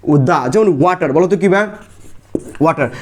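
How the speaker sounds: ordinary talk, nothing marked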